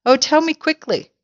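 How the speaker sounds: high, urgent storybook plea; crying out. A narrator's reading. Mrs Twit begging to hear the cure, crying out. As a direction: plead high, desperate to know. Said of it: "she cried".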